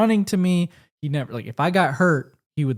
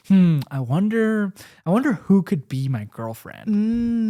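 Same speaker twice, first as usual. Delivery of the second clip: slow, higher musing daydream voice; dreamy wondering; 6 semitones higher, slower than usual. Retold or imitated. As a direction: wonder aloud, slowly and higher. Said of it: "I was like".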